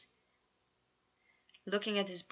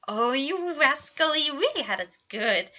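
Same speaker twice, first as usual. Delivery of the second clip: delighted teasing; 7 semitones higher, 10 dB louder than usual. A narrator's reading. Patton scolds the pranksters fondly, loud and high. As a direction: tease them like a proud dad.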